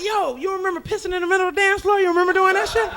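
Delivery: mock scolding friend. A touch of a friend teasing the drunk. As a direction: rib her about last night. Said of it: "You be like".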